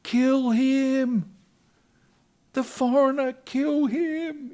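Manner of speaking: very high, very loud mob chant; angry crowd yelling to kill the foreigner. Retold or imitated. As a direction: scream it like an enraged crowd calling for blood. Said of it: "they start yelling".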